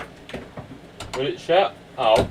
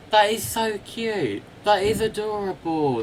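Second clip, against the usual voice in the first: ordinary talk, nothing marked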